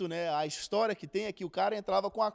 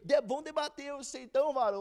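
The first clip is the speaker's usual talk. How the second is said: a mock angry challenge, pushed up and louder; angry retort; 6 semitones higher, 6 dB louder, faster than usual. Friend challenges him to debate, mock angry retort. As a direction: play the offended friend firing back. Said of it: "Aí ele ficou bravo".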